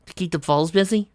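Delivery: usual speaking voice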